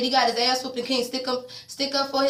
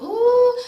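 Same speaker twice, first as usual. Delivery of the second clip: high, loud drawn-out ooh; squealed ooh of shock; 13 semitones higher, 8 dB louder than usual. Real, not acted. Shocked reaction to scene.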